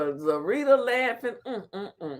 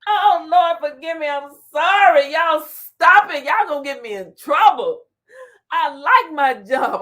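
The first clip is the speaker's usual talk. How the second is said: giggling, louder, pleading forgive me; laughing apology; 5 semitones higher, 7 dB louder than usual. Real, not acted. Vlogger apologising to viewers while laughing, loud and pleading. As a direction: laugh through a mock-guilty apology.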